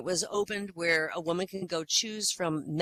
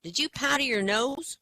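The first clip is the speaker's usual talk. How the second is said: put-on little girl voice; 9 semitones higher, 3 dB louder than usual. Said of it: "she goes".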